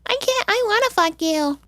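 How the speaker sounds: high, giggly airhead voice; mock ditzy girl. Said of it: "that girl's dumb 'cause she was like".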